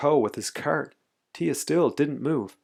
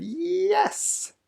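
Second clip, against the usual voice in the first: very high, stretched-out Y; escalating comic comeback; stubborn insistence. A narrator's reading. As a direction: top the last retort, sliding way up. Man insists again, escalating.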